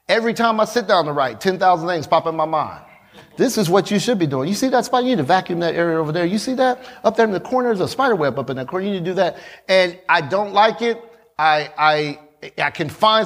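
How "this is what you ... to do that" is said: rapid-fire nagging inner voice; hurried, insistent interruptions; nagging distracting thoughts. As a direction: rattle off chores like a pestering thought. Acts out his distracting thoughts.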